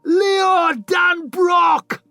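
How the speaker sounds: high, slow roaring cheer from the crowd; triumphant stadium shout. Acted. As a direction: bellow it out like a whole arena.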